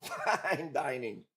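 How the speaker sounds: slow, laughing mock praise; laughing sarcasm. Real, not acted. Streamer mocking a gas station burger, laughing sarcasm. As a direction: scoff it through a laugh.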